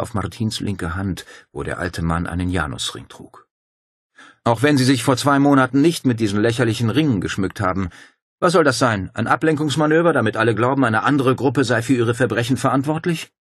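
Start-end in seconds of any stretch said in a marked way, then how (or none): 4.4-13.3 Paul's voice. Acted. Paul challenges Martin.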